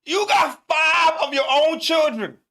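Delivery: slow, loud, high, crying-out disbelief; outraged shout. Real, not acted. Reacting to the no-cook wife, outraged shout. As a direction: yell it at the screen, appalled. Angry outburst at the wife's video.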